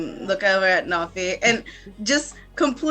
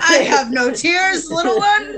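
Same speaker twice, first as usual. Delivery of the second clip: hammed-up stoic warrior, higher and louder, laughing; mock tough; 7 semitones higher, 6 dB louder than usual. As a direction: deny the tears with fake bravado. Player voices tough character.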